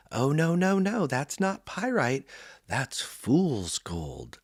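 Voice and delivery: high mock-lady voice, slowing right down at the end; a hint of a know-it-all lady setting a kid straight; correcting lady. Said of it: "she's like".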